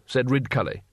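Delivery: ordinary delivery, nothing marked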